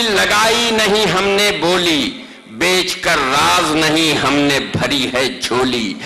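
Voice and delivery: rising, declamatory mushaira recitation; stately nazm recitation. Acted. Poet recites nazm stanza. (پرجوش مشاعرے کی نظم خوانی)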